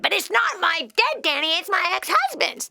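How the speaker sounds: a hint of a defensive caller; mimicking the caller's excuse to her face; mock whiny caller. Host mimics the caller's objection.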